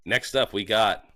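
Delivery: usual speaking voice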